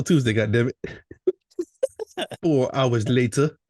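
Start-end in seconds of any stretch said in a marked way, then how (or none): 2.4-3.6 slow, drawn-out time-skip announcement; mock announcer marking time passing; SpongeBob time-card voice. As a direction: stretch each word like a cartoon time card. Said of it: "that's like SpongeBob".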